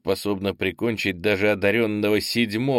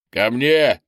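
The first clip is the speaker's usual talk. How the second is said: loud, raised-pitch commander's yell; yelled order; 4 semitones higher, 7 dB louder than usual. A narrator's reading. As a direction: yell it out as an order to your men. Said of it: "заорал Орнис". (громкий командный окрик)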